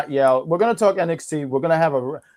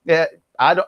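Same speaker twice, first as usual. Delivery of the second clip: ordinary talk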